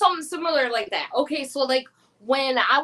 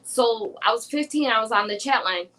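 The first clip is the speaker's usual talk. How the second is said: ordinary delivery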